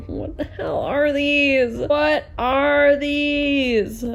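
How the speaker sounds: high, slow, half laughing, half crying; laugh-cry in disbelief at the awful books; laughing despair. Real, not acted. Vlogger to viewers, overwhelmed by the search, laughing and nearly crying. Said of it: "I'm gonna cry".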